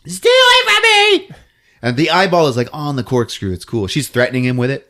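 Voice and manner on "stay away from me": very high, loud shriek; hammed-up victim warding him off; mock terrified scream. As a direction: squeal it in mock panic.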